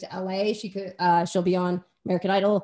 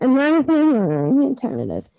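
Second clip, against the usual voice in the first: loud, higher radio announcer read; radio station ID; 4 semitones higher, 7 dB louder than usual. Pretend. Guest plugging a radio station on air, mock announcer voice. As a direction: belt it out like a station ident.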